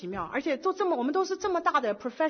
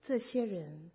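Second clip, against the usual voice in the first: slow, soft and solemn; voice of the Lord; 5 semitones lower, 6 dB quieter, much slower than usual. Retold or imitated. The Lord tells her at breakfast, slow and hushed. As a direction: speak each word slowly like a quiet divine voice. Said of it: "主说".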